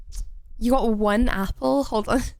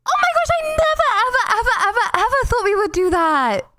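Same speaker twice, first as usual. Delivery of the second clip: loud high laughing disbelief; excited laughing shout; 10 semitones higher, 4 dB louder than usual. Real, not acted. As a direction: squeal with joy and laugh through it. Thrilled at an unexpected win.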